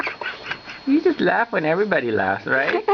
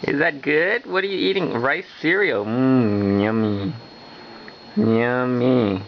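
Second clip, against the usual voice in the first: ordinary talk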